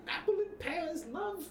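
put-on lightly high Cockney accent; a playful hint of Cockney; teasing Cockney accent; mock Cockney.

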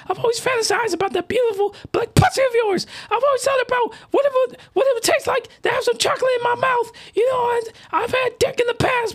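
very high put-on falsetto; man's falsetto impression of a woman; female voice. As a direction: confess it in a squeaky woman's voice. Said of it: "my female voice".

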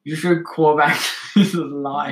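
a light Irish lilt, slowed; a playful Irish brogue, lightly done; Irish accent. Acted. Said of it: "you just went Irish so fast".

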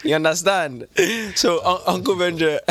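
a light Nigerian uncle voice, playful; Nigerian accent. Pretend. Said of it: "I'm going to do it in this accent".

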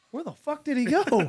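high-pitched mock alarm; startled bewilderment. Jesse finding the traveler gone behind a curtain, startled. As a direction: imitate someone suddenly baffled by a disappearance. Companion baffled by vanished traveler.

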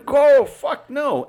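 high, loud, slow drawn-out refusal; a high, loud and slow outraged no; retold outburst. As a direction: yell it in disbelief, stretching every word. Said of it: "And I'm like".

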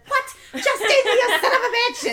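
high, loud mock-furious yell; hammed-up outburst of insults; outraged cursing. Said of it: "She's calling him names".

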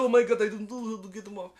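high, loud hammed-up panic; mock panic. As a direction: squeal it like a terrified man. Man panicking, dinos on legs.

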